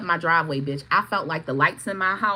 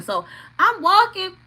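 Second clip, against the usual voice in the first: ordinary talk, nothing marked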